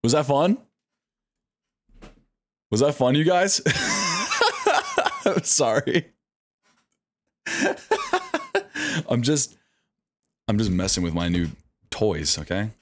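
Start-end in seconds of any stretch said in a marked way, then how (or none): 3.7-5.2 a high, squealing burst of laughter. Real, not acted. Streamer laughing at own toys, high squealing laugh. 7.4-9.0 squealing laugh. Real, not acted. Laughing at own antics.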